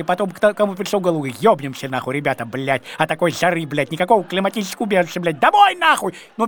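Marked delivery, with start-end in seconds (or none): 5.4-6.1 high yell to go home; shouted. Retold or imitated. Fed-up tourist yelling to go home from Cuba, loud high shout. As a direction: yell it out, desperate to leave. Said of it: "первый я, конечно, закричал".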